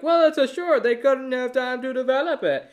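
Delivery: high, loud whiny mimicry; mocked excuse. Podcaster mocking excuses for the short, high and loud. Voicing an imagined defender's excuse.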